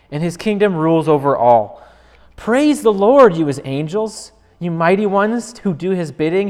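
fervent scripture recital; psalm reading. Acted.

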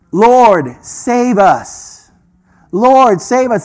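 loud, high plea to God; crying out a plea. Retold or imitated. Translating the worshippers' cry for the congregation, loudly pleading. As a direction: call out urgently, lifting the first word. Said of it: "which is".

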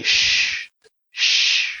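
long hissed "shh"; imitated shushing. Pastor at the wheel quieting his passengers, imitated shushing. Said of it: "And he would say".

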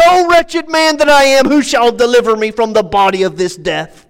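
high, loud outburst easing back down; frustrated exclamation. Retold or imitated. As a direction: boil over on the first words, then subside. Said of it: "he finally boils over and says".

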